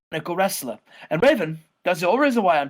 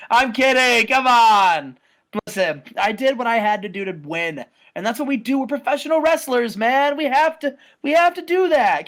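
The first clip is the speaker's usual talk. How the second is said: higher, louder pleading; mock placating; 6 semitones higher, 4 dB louder than usual. [name] placating Jeff, higher and louder. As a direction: talk him down with a grin.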